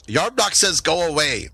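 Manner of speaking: high-pitched, dismissive goblin; goblin messenger. Acted. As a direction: send them away in a high goblin voice. Game master voices goblin messenger.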